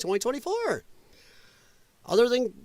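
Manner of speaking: usual speaking voice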